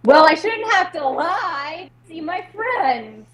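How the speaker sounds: high, slow, plaintive protest; wounded retort. Acted. Performer protesting to friends who blamed the lie, wounded. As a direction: plead your case with hurt drawn out. Performer pleads back in acted quarrel.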